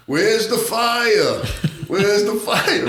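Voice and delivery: high, drawn-out holler; mock clueless call-out. Bobby pushing the cart up to firemen, high mock holler. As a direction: stretch it out high, playing the fool. Bobby calling out, acting drunk.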